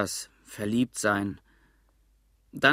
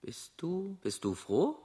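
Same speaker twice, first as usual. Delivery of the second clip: put-on high, soft, hesitant girl's call; faint girl's voice; 6 semitones higher, 7 dB quieter than usual. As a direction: call it softly across the roofs. Isabel's faint voice calls. (Isabel ruft leise und zögernd über die Dächer)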